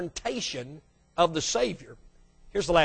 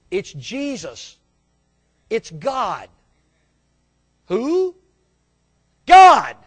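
acted exchange; 5 dB louder, much slower than usual. Jesus answering the homeowner at the door, loud. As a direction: bark the name back loud.

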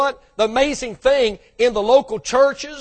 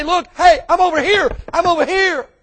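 raised, higher calling voice; 9 semitones higher, 6 dB louder than usual. Acted. Preacher voicing Jesus calling from outside the door, raised. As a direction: call out to be noticed, louder and higher.

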